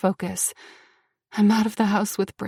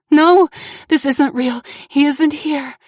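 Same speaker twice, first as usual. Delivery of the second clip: panicked; 5 semitones higher, 7 dB louder than usual. A narrator's reading. Panic, denying flashback.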